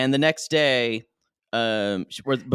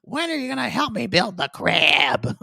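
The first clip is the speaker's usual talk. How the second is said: high, put-on nagging voice; imitating Tater; 11 semitones higher than usual. Host imitates Tater asking for crib help, high mock voice. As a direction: mimic her pestering him in a raised pitch. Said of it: "No, she's like".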